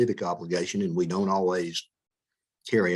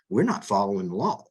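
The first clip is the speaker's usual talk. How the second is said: ordinary delivery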